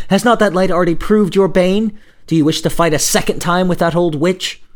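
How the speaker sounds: loud, high-pitched urgent questions; alarmed cry. Acted. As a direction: plead with him loudly not to go. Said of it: "she cried".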